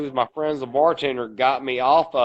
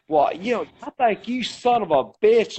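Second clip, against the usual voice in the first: higher, sneering insult; mock-hostile, higher-pitched jab; retold insult; 7 semitones higher than usual. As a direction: imitate the stranger spitting out the insult. Stranger insults him at bar.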